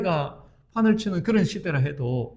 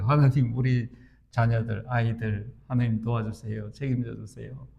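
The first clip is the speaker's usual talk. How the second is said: hushed prayer; 8 semitones lower, 6 dB quieter than usual. Retold or imitated. Voices his prayer for distant children.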